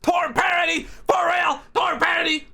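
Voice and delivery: high-pitched shocked outcry; shocked disbelief. Real, not acted. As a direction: cry out in stunned disbelief. Shocked by review's parody remark.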